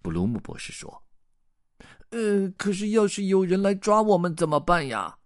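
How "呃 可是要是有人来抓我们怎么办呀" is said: high-pitched anxious fish voice; high character voice, uneasy question; worried goldfish. Acted. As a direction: ask it up high, like a fretting little fish. Goldfish worriedly asks about getting caught.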